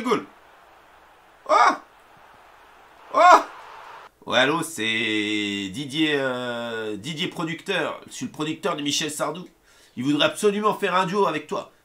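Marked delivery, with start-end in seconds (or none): 4.3-11.7 voix plus grave, plus douce, de producteur; faux producteur au téléphone. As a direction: joue Didier le producteur qui appelle. Joue un faux appel du producteur. (il imite Didier le producteur au téléphone, plus grave)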